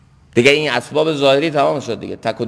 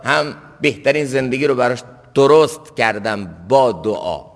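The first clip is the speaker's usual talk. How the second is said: ordinary talk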